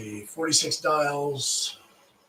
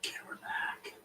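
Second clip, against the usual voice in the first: hushed whisper, no voice; 13 dB quieter, faster than usual. Real, not acted. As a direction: whisper so the call won't hear. Speaker drops to a whisper.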